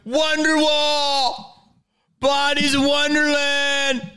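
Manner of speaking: high, slow shouted song requests; mock fan shouting requests. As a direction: yell it like a rowdy fan at a gig. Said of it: "You guys wouldn't be like".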